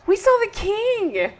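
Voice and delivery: high, slow thrilled announcement; breathless with delight at seeing the king; excited reveal. Real, not acted. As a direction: savour the news of seeing the king.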